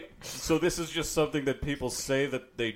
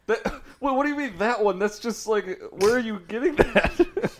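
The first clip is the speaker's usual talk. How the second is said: laughing disbelief; 8 semitones higher, 4 dB louder than usual. Real, not acted.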